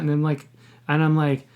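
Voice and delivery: ordinary delivery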